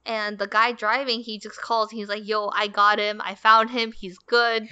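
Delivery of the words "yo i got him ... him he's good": loud, upbeat call into the phone. Retold or imitated. Golf cart driver calls managers, loudly reporting the lost guy found. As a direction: shout the news down the line like a mock radio report.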